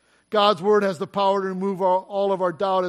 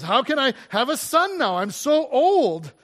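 incredulous protest; 5 semitones higher, 3 dB louder than usual. Acted. Acted incredulous Abram.

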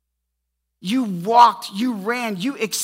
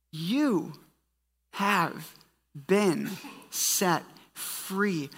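slow emphatic; 6 dB quieter, much slower than usual. Acted. Preacher slowly declaring freedom.

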